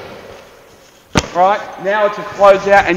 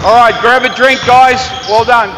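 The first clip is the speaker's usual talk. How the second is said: higher, louder call to the whole gym; 5 semitones higher, 7 dB louder than usual. Real, not acted. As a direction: shout the break to the team. Coach calls break to team.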